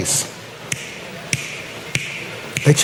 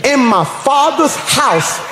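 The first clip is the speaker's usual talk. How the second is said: slow and ringing, each word stretched; slow, emphatic; 3 semitones higher, much slower than usual. Shown as a demonstration. Preacher trying the verse once more on the congregation, slow and emphatic. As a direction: draw it out for those who missed it. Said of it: "I'm gonna say this slow".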